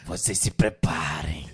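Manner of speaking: imitating a grumpy old Lula, low and slow; voz de velho rabugento. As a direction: sussurro rouco de velho, lento e grave. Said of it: "Ele com a voz de velho". (imitação de velho ranzinza, voz baixa e arrastada)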